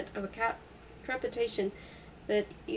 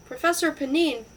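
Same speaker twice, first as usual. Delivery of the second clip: loud, higher-pitched exclamation; raised voice, astonished husband; crying out; 5 semitones higher, 6 dB louder than usual. A narrator's reading. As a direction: burst out in recognition. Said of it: "cried Lawrence".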